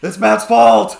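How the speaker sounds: loud, high accusing yell; mock furious shout. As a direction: cry out blame in pretend outrage. Playful blame when light goes out.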